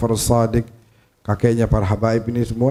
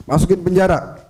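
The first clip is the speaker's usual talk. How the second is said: higher-pitched mock order to jail someone; acted command; 5 semitones higher than usual. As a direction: give the order in a raised pitch.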